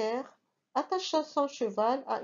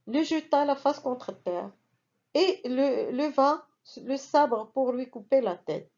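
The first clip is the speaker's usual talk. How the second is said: ordinary delivery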